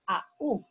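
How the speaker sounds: "a u" as two distinct vowels; separated vowels. Shown as a demonstration. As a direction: sound out "a u" with a break between a and u. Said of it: "El diptongo es".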